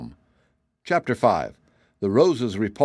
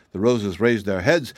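plain reading voice

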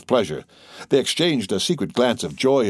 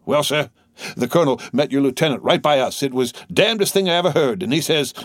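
higher-pitched, eager soldier's yarn; excited young soldier storyteller; 7 semitones higher than usual. A narrator's reading. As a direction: relay thrilling news to comrades. Soldier telling eagerly of colonel's words.

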